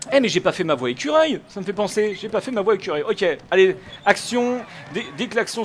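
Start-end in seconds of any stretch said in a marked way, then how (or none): none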